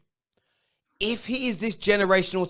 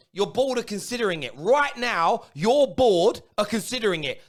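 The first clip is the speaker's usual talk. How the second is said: ordinary delivery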